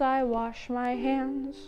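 ordinary delivery

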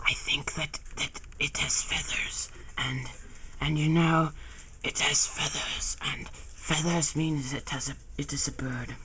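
hushed, breathy aside whisper. Acted. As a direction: whisper it like a timid sidekick. [name] speaks in a whisper.

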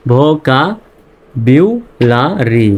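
ভোকাবুলারি drawn out slowly, বিউ clearly sounded; slow teacher read of ভোকাবুলারি; slow syllable demo. Shown as a demonstration. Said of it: "তো এখানে বিউ উচ্চারণ হবে".

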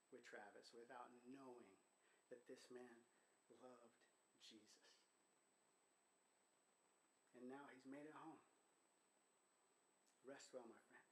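a friend saying goodbye at the funeral, real grief; grieving farewell. Real, not acted. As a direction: let the voice sink as you say goodbye. Eulogy farewell to dead friend.

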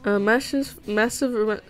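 normal talking voice

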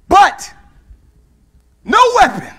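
preacher roaring the promise at the church; full-force preaching. Acted. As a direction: build to a ringing shout on no weapon. Preaching climax.

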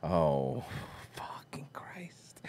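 a slow, breathless whisper of disbelief; whispered exasperation. Retold or imitated. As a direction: mutter it under your breath, dragging it out. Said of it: "even D'Elia was like".

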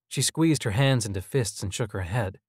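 ordinary delivery, nothing marked

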